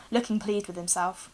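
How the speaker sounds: ordinary delivery, nothing marked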